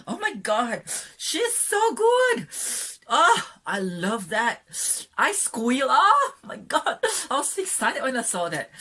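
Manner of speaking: high, loud fangirl gushing; very excited. Real, not acted. Fan raving to viewers about a favorite movie scene, very excited. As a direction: squeal it out, drawing out the big words. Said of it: "I'm just very excited".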